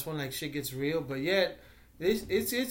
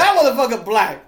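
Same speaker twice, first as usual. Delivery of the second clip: mock outrage; 6 semitones higher, 12 dB louder than usual. Quoted reaction after I'm like.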